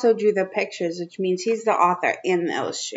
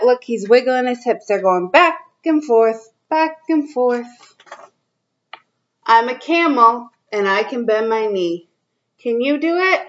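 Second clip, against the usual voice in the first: put-on reading to children; 3 semitones higher, 3 dB louder than usual. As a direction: read it out to little kids, drawing each line out. Reads picture book to kids.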